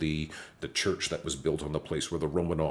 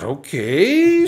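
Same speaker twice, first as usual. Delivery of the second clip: high, loud startled okay; blurted out in shock; surprised okay; 13 semitones higher, 13 dB louder than usual. Retold or imitated. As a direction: caught off guard, yelp it. Startled reply to "Now.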